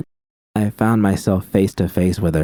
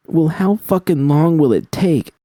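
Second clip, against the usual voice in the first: high, bitter, pushed out; bitter hiss; 9 semitones higher, 3 dB louder than usual. A narrator's reading. Judy hissing at V in bitter frustration. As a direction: spit out the question in bitterness. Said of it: "I hissed".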